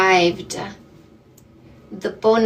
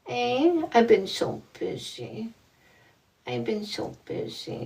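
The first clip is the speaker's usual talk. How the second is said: voicing the dog; 7 dB quieter than usual. Host speaks softly for her dog, a light pet voice.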